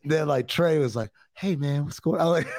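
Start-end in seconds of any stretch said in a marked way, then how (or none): none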